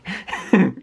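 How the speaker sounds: short high laugh; amused laugh. Real, not acted. Vlogger laughing at the creep impression, amused laugh. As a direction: laugh at your own impression.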